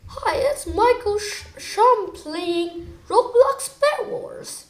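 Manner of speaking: high, badly faked British accent; terrible British accent. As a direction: put on a bad British YouTuber intro voice. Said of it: "That was terrible British accent".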